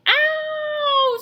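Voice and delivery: very high, loud yelp; wolf landing in boiling water, screaming; scream of pain. A narrator's reading. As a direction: yelp as if burned. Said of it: "screamed the wolf".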